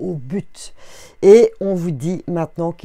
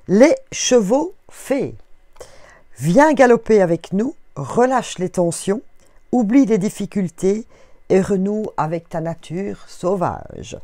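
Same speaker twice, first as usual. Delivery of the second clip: card message read aloud; 3 semitones higher, 4 dB louder than usual. Acted. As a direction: proclaim the card like an inspired message. Reads the fairy card's message. (lecture de carte, ton inspiré)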